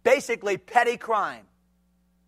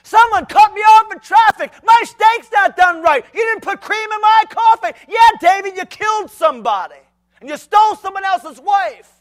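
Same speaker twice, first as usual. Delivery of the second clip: hammed-up high angry shout; mock furious shouting; mad ranting; 11 semitones higher, 11 dB louder than usual. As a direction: rant like petty outrage. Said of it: "someone has made him mad".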